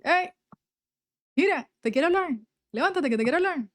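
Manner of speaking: Dios llamándola más agudo, con cariño insistente; voz de Dios. Retold or imitated. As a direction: imitando a Dios que la despierta, voz más aguda. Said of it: "Él me habla mucho así". (Dios la llama de noche, voz aguda e insistente)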